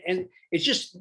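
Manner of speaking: usual speaking voice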